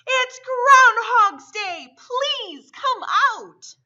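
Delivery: loud, slow crowd cry; town shout. A narrator's reading. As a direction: call it out like a whole town. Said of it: "said with a shout".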